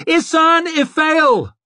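high drawn-out desperate scream; screeched name. Acted. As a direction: shriek her name, stretched long. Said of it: "he screeched".

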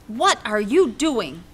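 slow, loud teacher demand; stern shout. Acted. Teacher demanding Junie B. explain herself, stern shout. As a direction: drag it out like a cross teacher. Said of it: "shouted Missus".

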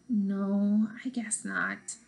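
whispered, drop the voice to a hush; quiet whispered admission; softly. Acted. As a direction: barely breathe it out, softly. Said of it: "then more softly".

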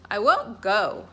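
put-on loud childish defiant shout; defiant refusal. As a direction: yell it like a stubborn kid refusing to budge. Defiant child refusing her mother.

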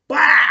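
loud "bow" punch noise; shouted punch sound effect. Retold or imitated. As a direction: smack it out like a punch landing.

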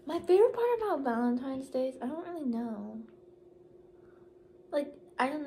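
talking around a straw; straw in mouth. Real, not acted. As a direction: lips on drinking straw. Seen 1: Pink straw held against her lips while speaking, then hand covers mouth.

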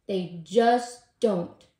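loud, slow, drawn-out groan. A narrator's reading. Tortoise answering Squirrel's question, groaning. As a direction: groan it heavily, word by word. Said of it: "groaned Tortoise".

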